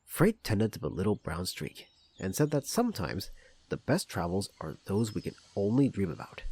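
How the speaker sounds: ordinary delivery, nothing marked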